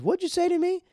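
high-pitched, indignant mock challenge; pissed-off retort. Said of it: "it pisses him off".

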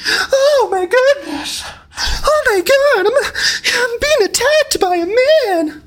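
very high, drawn-out wail; mock whining victim. Mocking women's reaction to men.

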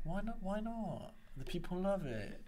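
put-on high, protesting British accent. Co-host defending the accent to the other host, put-on British accent. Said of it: "Stop using the accent".

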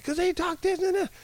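put-on high, whining young boy; whiny kid voice. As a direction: whine it like a sulky little kid. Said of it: "I said".

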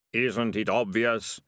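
slow, dazed echo of the voice; ratcatcher echoing. Acted. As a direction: repeat it as if your own thought. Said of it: "said Ratcatcher One".

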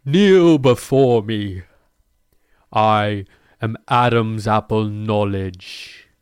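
slow, loud, commanding decree; booming demon lord. Pretend. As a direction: boom it down slowly from the pulpit. Commands congregation from podium.

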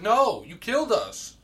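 loud, high, drawn-out rebuttal; exasperated accusation. Retold or imitated. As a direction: stretch it out in retold outrage. Retold accusation in quarrel.

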